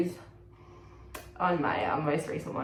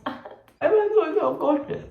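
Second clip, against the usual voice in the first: high, loud, slow parody; mock self-conscious; 11 semitones higher, 8 dB louder, slower than usual. Vlogger parodies what they don't mean, mock self-conscious. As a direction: draw it out in a put-on high voice. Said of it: "I'm not trying to sound like".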